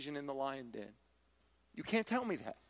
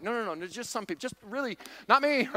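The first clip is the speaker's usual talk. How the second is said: panicky denial; 6 semitones higher, 6 dB louder than usual. Acted. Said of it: "Now they're like".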